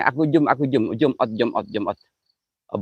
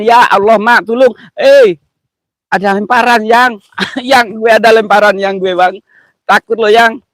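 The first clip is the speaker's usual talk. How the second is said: louder, higher frightened cries; real panic; 8 semitones higher, 8 dB louder than usual. Real, not acted. Host startled by something thrown at him, cries to his partner in fright. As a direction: cry out in real alarm at a thrown object.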